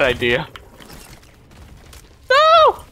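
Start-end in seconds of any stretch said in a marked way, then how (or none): 2.3-2.8 high shrill panicked no; high shriek. Real, not acted. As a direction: scream it in panic. Near death in game.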